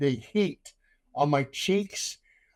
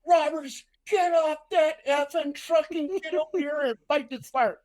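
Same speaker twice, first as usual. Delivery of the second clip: captain yelling; 11 semitones higher than usual. Retold or imitated. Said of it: "the captain goes".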